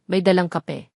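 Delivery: plain reading voice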